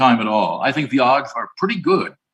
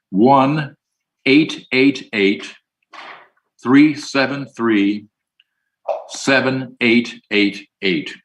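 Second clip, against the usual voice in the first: slow dictation for someone writing it down; slow, deliberate repetition; much slower than usual. Shown as a demonstration. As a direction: read the number out very slowly, digit by digit. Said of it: "slowly because I want you to write it down".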